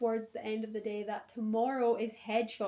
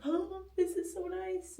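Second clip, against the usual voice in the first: high, slow, dreamy sigh; awed; 11 semitones higher, slower than usual. Retold or imitated. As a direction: breathe it out in awe. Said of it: "I'm like".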